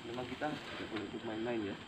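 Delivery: soft, shaken warning; hushed warning. Real, not acted. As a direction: breathe it out, still shaken. Solemn warning after the attack.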